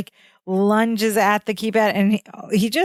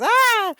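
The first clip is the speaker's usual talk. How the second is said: startled yelp; 13 semitones higher than usual. Retold or imitated. Balky sees the beams.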